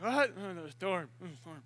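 low, soft, half-awake murmur; mock sleepy muttering; groggy mumbling. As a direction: mumble it barely audibly. Groggy Jesus addresses the storm.

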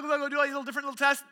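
ordinary delivery

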